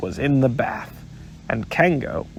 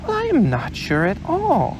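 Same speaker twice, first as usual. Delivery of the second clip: thoughtful Kanga; 11 semitones higher than usual. Acted. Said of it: "in a thoughtful voice".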